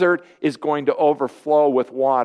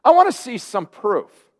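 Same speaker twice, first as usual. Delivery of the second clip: sceptical challenge; 3 semitones higher, 4 dB louder than usual. Pretend. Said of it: "Is the woman saying to Jesus".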